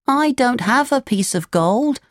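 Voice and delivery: put-on high puppet boy voice; Pinocchio, helpless. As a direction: answer as a penniless little puppet. Pinocchio answers the farmer.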